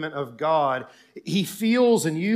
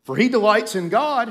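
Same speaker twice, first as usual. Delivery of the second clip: loud, high, jeering recitation; scornful mockery thrown out in bursts; mocking; 6 semitones higher, 11 dB louder than usual. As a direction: taunt the sufferer from the crowd. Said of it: "it's a mockery".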